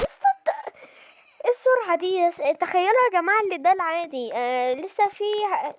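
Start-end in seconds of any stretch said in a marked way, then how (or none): 0.0-0.5 very high-pitched panicked shout; startled shriek. Real, not acted. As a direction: yelp at the sudden attacker. Startled by very fast enemy. (بصرخة خضة عالية)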